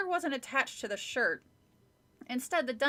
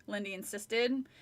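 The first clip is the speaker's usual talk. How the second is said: ordinary delivery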